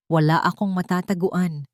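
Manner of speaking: normal talking voice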